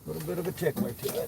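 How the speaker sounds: quick, high and playful. Pretend. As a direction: sing it out teasingly, quick. Playful repeat of his nickname.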